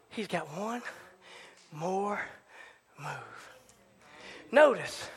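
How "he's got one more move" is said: soft and low, a pause between "one", "more" and "move"; slow, hushed refrain. Real, not acted. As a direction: spell it out word by word. Slow solemn close of refrain.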